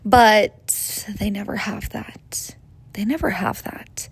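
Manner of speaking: breathy hushed whisper, slowed down. Acted. Telling the audience about men, in a whisper. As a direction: let it slip out as a secret whisper.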